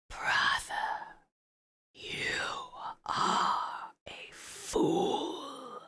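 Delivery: soft, very slow ghost whisper; ghostly whisper. Acted. Dead Cobra scorning his brother, slow ghostly whisper. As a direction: breathe it like a ghost. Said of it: "the ghostly Cobra says".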